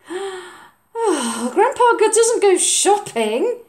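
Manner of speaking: high, loud, slow dismay; high child voice, scandalised; shocked boy. Acted. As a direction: gasp at the very idea. Oliver's voice.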